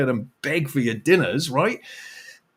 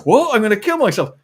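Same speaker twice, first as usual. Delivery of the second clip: higher, louder startled reply; shocked protest; 7 semitones higher, 5 dB louder than usual. Retold or imitated. Shop visitor protesting the joke, startled and louder. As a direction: react in disbelief. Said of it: "They go".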